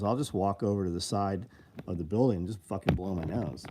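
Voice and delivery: usual speaking voice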